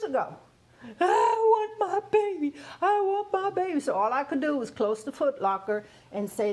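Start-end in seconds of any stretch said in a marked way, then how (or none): 1.0-3.8 high, louder mock crying wail; imitating her wail. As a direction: wail it like a grieving mother. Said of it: "she's in the garage screaming".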